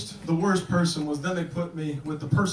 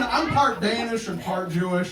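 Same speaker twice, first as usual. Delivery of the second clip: ordinary talk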